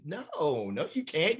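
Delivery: usual speaking voice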